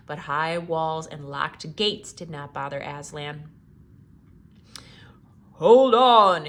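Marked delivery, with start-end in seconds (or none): 5.5-6.5 very loud roaring call. Acted. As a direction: roar the warning as a lion. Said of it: "he roared".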